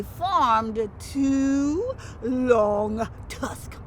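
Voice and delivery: very slow, stretched reveal; drawn out. A narrator's reading. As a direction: stretch it for effect.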